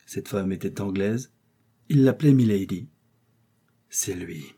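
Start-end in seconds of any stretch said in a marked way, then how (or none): 3.9-4.4 low murmur under the breath; murmured recognition. A narrator's reading. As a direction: mutter it to himself, stunned. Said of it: "murmura Tréville".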